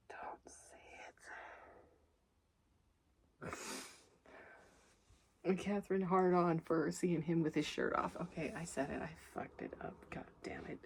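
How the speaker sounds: hushed whisper to herself; guilty whispered muttering to herself; whispered self-scolding. Real, not acted. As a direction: whisper it like you've been caught. Scolding herself for a crude pun.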